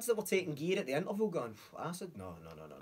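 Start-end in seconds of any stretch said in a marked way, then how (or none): 1.8-2.8 scared refusal. Retold or imitated. Mocking scared audience members.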